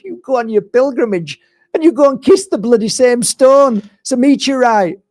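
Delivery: high, loud mocking rant, half laughing; mocking scoff. To pilgrims, mocking that it's the same stone: high loud scoff. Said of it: "and I can say".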